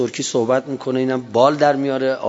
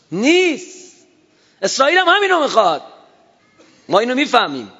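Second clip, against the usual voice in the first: emphatic outburst; 10 semitones higher, 3 dB louder than usual. Real, not acted. Preacher's emphatic denial, pitch jump.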